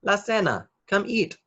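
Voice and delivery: high, sing-song call. A narrator's reading. Mommy calls Nacho to dinner, high sing-song call. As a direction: call the family to the table. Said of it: "cried Mommy".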